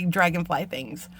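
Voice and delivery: normal talking voice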